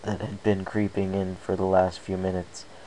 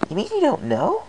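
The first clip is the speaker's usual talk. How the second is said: much higher, shocked question; startled; 4 dB louder than usual. Acted. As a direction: gasp it out in disbelief. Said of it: "she asked in a startled tone".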